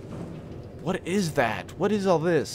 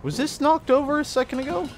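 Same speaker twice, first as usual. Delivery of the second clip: startled question. Real, not acted. Surprised by scattered trash.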